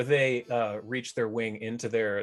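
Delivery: normal talking voice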